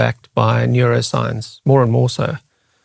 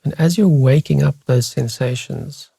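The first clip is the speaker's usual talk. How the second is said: guided meditation. Real, not acted. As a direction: lead listeners unhurriedly through their body, leaving space after each phrase. Leads a guided body scan.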